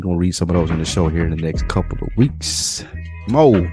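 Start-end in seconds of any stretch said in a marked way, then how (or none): none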